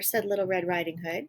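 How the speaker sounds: plain reading voice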